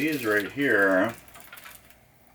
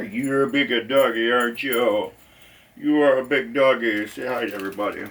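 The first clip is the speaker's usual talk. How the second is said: talking to a dog; 4 semitones higher than usual. Real, not acted. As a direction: coo at a big dog.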